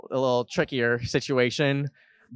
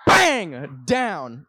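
loud, higher burst; sudden crash exclamation; 3 semitones higher, 5 dB louder than usual. Retold or imitated. Host acts out the fall on the stairs, loud burst. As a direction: punch it out like a crash.

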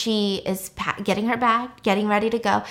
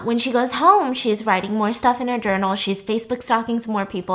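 ordinary talk, nothing marked

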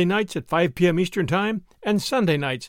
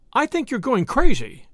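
high, louder nervous protest; 6 semitones higher, 5 dB louder than usual. A narrator's reading. Wife to her raging husband, nervous protest. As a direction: nervously push him away, voice raised. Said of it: "she said nervously".

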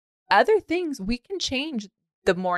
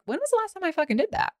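high, startled self-quote; sudden realization; 11 semitones higher than usual. Retold or imitated. Retelling her own sudden shock, a high startled blurt. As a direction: blurt it out as it dawns on you. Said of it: "I was like".

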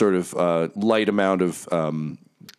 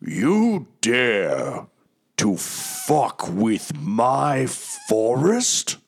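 higher, slow, menacing villain roar; booming voice; 5 semitones higher, much slower than usual. Acted. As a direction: boom it down like a dark god. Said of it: "a booming voice".

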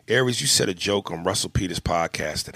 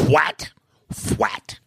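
mock snapping dad; 6 semitones higher than usual. Dad snaps at child.

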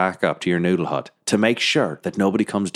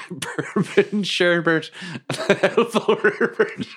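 slow words through laughter; laughing; 10 semitones higher, much slower than usual. Real, not acted. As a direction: giggle out the name. Player laughing at name.

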